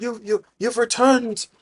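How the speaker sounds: high, slow, overwhelmed relief; acted stammering joy at a son's return; tearful father. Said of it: "They start to cry".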